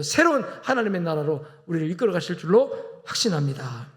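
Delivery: ordinary talk, nothing marked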